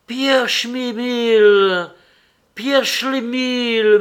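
high, loud, drawn-out joyful call. A narrator's reading. Narrator calling after Schlemil, joyful and loud. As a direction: call after him with delight. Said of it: "m'écriai-je tout joyeux".

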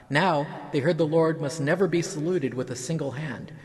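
ordinary delivery, nothing marked

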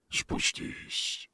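breathy unvoiced creature mutter; a low rasp from the dark; muttering monster voice. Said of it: "пробормотал голос".

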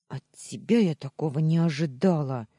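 loud, indignant reproach; reproachful hiss. A narrator's reading. Betrayed wife to the heroine, reproachful hiss. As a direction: throw the blame sharply at her. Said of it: "зашипела Таня".

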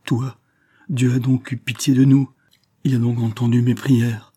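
high, loud rush of joy and disbelief; passionate cry. A narrator's reading. Valentine cries out, overcome by passion for Gaston. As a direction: cry out wildly in passionate relief. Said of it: "d'une voix où éclatait toute la folie de sa passion".

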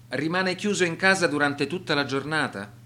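ordinary talk, nothing marked

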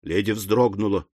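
plain reading voice

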